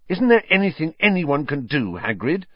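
fierce. Acted. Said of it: "Harry asked fiercely".

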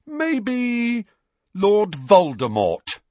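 slow, pointed mock guess; mock thoughtful. A narrator's reading. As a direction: muse sarcastically, then land the name hard. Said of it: "in a mock thoughtful voice".

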